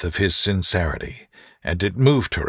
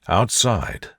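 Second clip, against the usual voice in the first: ordinary delivery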